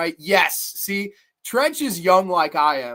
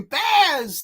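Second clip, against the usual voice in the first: mock scream; 8 semitones higher than usual. Reviewer repeats the villain's scream of the name. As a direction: yell the name once more. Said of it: "He's screaming".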